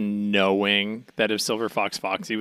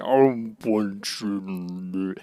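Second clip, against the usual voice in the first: very slow drawn-out; 4 semitones lower, 3 dB quieter, much slower than usual. Pretend. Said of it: "then he's like".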